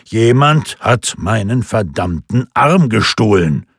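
"verdammten Arm gestohlen" pushed out loud; emphatic insistence. A narrator's reading. Lindqvist insists to the bar that his arm was stolen, emphatic. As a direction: hammer home "verdammten Arm gestohlen", loudly. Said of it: "betonte Lindqvist".

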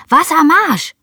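loud, high shout falling at the end; bellowed call. A narrator's reading. Child calls to the gardener for water, bellowing. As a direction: bellow it out like a command. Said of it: "brülle ich".

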